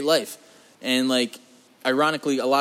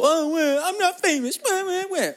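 way higher, fake crying whimper; mocking whine; slower than usual. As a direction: parody someone sulking about not being famous. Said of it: "sit around and be miserable".

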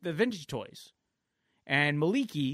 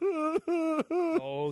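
crying laughing; 12 semitones higher than usual. Real, not acted. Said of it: "he cried so hard".

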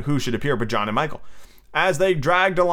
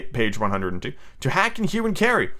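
plain reading voice

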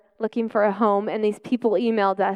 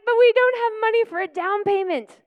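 higher, anxious pleading; panicked worry; 11 semitones higher than usual. Retold or imitated. Mother panics to God about rent she cannot afford. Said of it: "And I was like".

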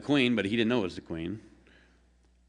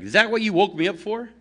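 much higher and louder, indignant yelling; angry rant; 12 semitones higher, 6 dB louder than usual. Acted. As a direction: scold her for waking him. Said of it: "The angry man inside is like".

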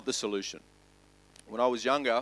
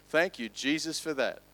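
ordinary talk, nothing marked

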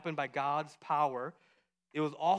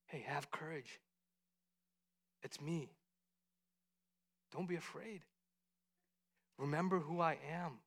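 very soft, calming voice; hushed, tender comfort; gentle reassurance; 12 dB quieter than usual. Acted. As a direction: soothe frightened friends quietly, with pauses. Voicing Jesus reassuring frightened disciples.

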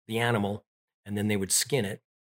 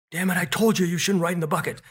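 scolding dad; 8 semitones higher, 4 dB louder, faster than usual. Retold or imitated. Dad scolding after injury.

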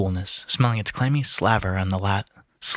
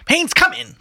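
high, loud summons; calling out; 5 dB louder than usual. A narrator's reading. Mulligan calling Haynes to the table, calling out. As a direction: call him in from outside.

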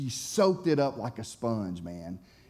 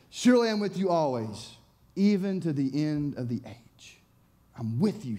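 ordinary delivery

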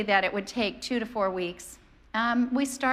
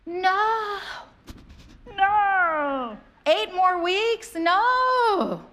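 shocked disbelief; 8 semitones higher, 3 dB louder, much slower than usual. Retold or imitated.

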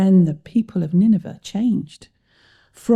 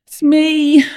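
high, loud groaned confession; groaning; 8 semitones higher, 6 dB louder than usual. A narrator's reading. Jonah owns up to the sailors, groaning. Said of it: "Jonah groaned".

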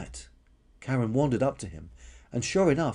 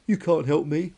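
mildly tearful, slightly raised protest; weepy; 5 semitones higher, 5 dB louder than usual. Acted. Crying dinosaur rejects the stranger's help, weepy. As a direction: turn away help with a touch of weeping. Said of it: "wept Karen".

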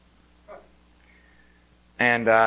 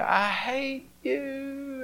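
high, drawn-out mock wail; imitated screaming child; 12 semitones higher, 7 dB quieter, much slower than usual. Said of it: "he's screaming".

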